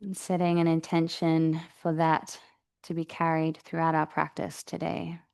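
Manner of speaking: calm, even meditation voice with long pauses; guided meditation. Real, not acted. Yoga teacher settling the class into breathing practice, guided meditation. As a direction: guide them gently into the breath, unhurried.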